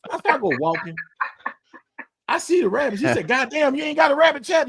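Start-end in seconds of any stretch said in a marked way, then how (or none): 3.3-4.7 a mock yell, higher and impatient; imitating a yell. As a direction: bark it like an impatient father-in-law. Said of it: "He yelling".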